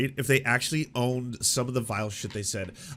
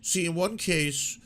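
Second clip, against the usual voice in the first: mock weaselly backpedal; 3 semitones higher than usual. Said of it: "Someone's like".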